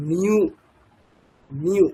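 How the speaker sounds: নিউ as one glide, ন sliding into উ; demonstrated pronunciation. Shown as a demonstration. Teacher showing নিউ to students, clear repetition. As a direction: model নিউ twice, clean. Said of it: "উকার থাকলে".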